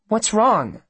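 put-on girl's questioning voice. A narrator's reading. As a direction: ask in a high, concerned voice.

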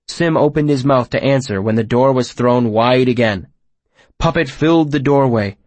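ordinary delivery

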